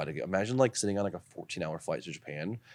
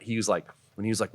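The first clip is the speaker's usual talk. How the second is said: normal talking voice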